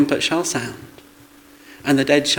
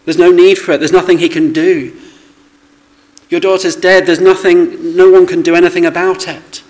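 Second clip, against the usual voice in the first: growing louder scornful voices, building to a loud scoff; mocking doubters' voices; 3 semitones higher, 6 dB louder than usual. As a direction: let the sceptics get louder and harsher line by line. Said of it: "people in the world telling us".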